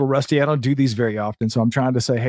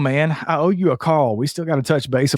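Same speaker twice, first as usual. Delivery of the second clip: ordinary delivery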